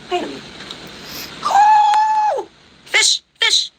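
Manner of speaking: high shrieking cry of shock; shocked scream. Real, not acted. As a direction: let out a sudden scream of surprise. Spirit box says her bestie's name.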